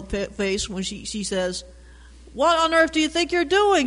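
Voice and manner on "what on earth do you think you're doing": high, raised scolding cry from mom; yelling mom. Acted. Scolding shout, pitched high. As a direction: mom catching him throwing ball through window. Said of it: "she yelled".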